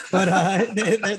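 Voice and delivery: laughing. Real, not acted. Laughing about Winning Time.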